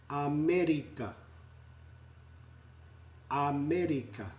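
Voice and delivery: "America" as a-meh-ri-ka, rolled r, flat even vowels; Māori pronunciation. Shown as a demonstration. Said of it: "That's the way".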